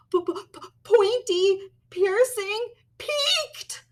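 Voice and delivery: very high, stuttering, frightened; scared stammer. Acted. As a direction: stutter in terror at the teeth. Stammering at teeth.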